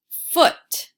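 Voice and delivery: "foot" blended, high and loud; blended word. Shown as a demonstration. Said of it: "I had the word foot".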